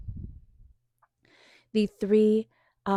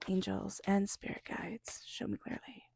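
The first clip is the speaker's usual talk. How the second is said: softer, then a near whisper; whispered invocation; 11 dB quieter than usual. Acted. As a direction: let the prayer fade into a whisper.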